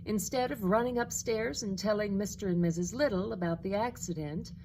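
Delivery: ordinary delivery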